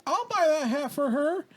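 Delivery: high, slow mock offer; imitated meddling stranger. Random lady to a stranger's dad, meddling. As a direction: put on a high voice for the butting-in lady.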